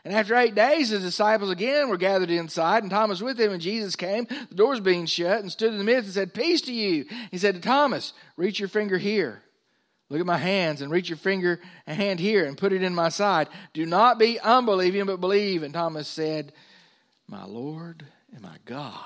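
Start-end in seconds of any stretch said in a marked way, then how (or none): 0.0-15.6 raised, emphatic pulpit reading; preacher ringing out scripture to a congregation. Acted. 17.3-18.9 slow, reverent near-whisper; awed whispered confession; hushed awe. Acted. Thomas acted, awed confession.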